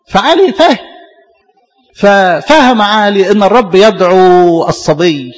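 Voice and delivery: slow, weighty stress on ففهم عالي; emphatic, drawn out. Real, not acted. Preacher to congregation, slow emphatic stress on ففهم. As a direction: lean hard on ففهم and stretch the line.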